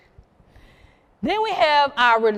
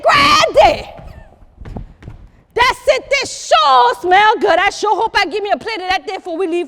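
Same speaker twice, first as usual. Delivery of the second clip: voiced guest; 6 semitones higher, 6 dB louder than usual.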